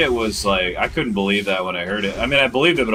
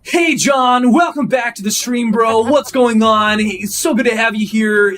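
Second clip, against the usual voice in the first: much higher, louder, shouted hype host; YouTuber voice; 14 semitones higher, 8 dB louder than usual. Retold or imitated. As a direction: overdo the fake streamer energy. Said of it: "This is my YouTuber voice".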